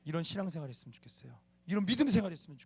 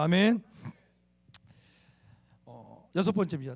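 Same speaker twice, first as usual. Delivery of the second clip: ordinary talk, nothing marked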